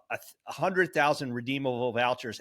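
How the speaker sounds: normal talking voice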